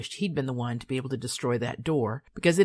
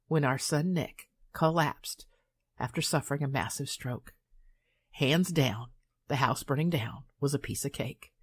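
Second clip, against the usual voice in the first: usual speaking voice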